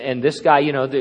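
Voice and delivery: usual speaking voice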